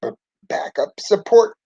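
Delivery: faltering, high-pitched weak voice. A narrator's reading. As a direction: stammer it feebly, pitched well up. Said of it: "Ralphie said weakly".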